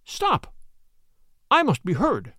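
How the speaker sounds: high insistent cry, then a firm demand; zealous, insistent outburst; fervent cry. Acted. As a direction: demand to be heard, full of zeal. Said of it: "spoke with the fervor that filled me".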